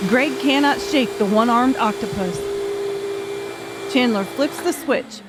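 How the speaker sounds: ordinary delivery, nothing marked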